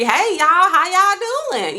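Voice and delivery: put-on Southern country accent, friendly and slow. Vlogger showing a super country voice, Southern accent. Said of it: "If I was super, like, country".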